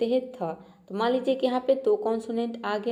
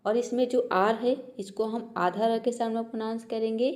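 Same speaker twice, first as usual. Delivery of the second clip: usual speaking voice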